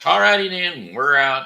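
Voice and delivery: high, loud all righty then; loud, high goodbye shout; loud sign-off. Real, not acted. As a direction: burst out the final exit.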